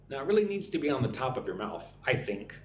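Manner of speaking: normal talking voice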